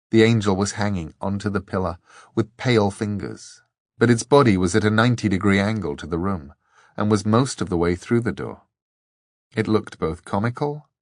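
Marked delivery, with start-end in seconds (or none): none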